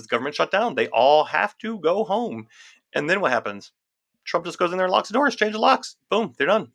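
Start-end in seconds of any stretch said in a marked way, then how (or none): 0.7-2.5 "They all have to go home" slowed right down, each word spelled out; slow, deliberate restatement of "go home"; slow emphatic repeat. Shown as a demonstration. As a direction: drag out "go home" to drive the point in. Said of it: "They all have to go home because".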